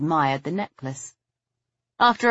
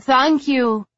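higher, louder called-out thanks; calling after someone; 9 semitones higher, 6 dB louder than usual. A narrator's reading. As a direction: call it out to someone walking away. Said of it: "Lady Tiverton called after him".

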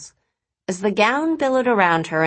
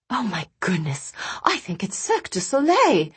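excited murmur; 3 semitones higher than usual. A narrator's reading. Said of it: "guests began murmuring excitedly".

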